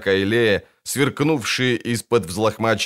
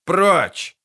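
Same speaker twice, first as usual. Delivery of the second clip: barked; 7 semitones higher than usual. A narrator's reading. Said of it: "невнятно рявкнул скомлик".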